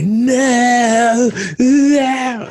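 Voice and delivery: high, loud drawn-out "no" as mock parents; hammed-up parental refusal; objecting parents. Retold parents' objection to teen.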